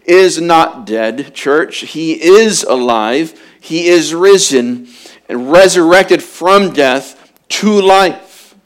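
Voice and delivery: rising preacher's cry, building in volume; preaching at full force. Acted. Preacher proclaiming the resurrection to his church, full force.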